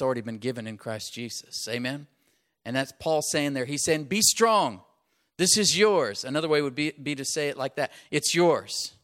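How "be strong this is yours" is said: louder, higher proclamation; ringing declaration of strength; mock command. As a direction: call it out like Paul commanding. Paul's command voiced by preacher.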